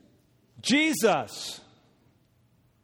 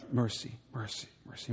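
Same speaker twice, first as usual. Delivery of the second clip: muttered under breath; 3 semitones lower, 8 dB quieter than usual. Retold or imitated. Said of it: "underneath my breath".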